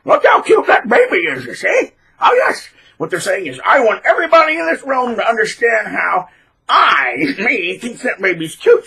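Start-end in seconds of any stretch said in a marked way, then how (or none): 0.0-2.7 put-on high, loud, over-the-top gushing over a baby; hammed-up fake adoration; obnoxious gushing. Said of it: "obnoxiously draw, go". 3.6-8.9 high, loud pompous showoff voice; hammed-up attention seeker; mock narcissist. Voicing narcissist's inner thoughts.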